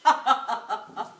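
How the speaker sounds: loud, scheming cackle; gloating laugh. Acted. Wife hatching a new revenge idea, gloating laugh. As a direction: laugh loudly over your new scheme.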